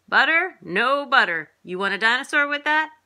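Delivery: high, loud hawker's call; shouting vendor. A narrator's reading. Popcorn man to theater customers, shouting. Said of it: "the popcorn man shouting".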